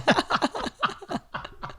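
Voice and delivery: high breathy laugh. Real, not acted. Laughing at friend's remark.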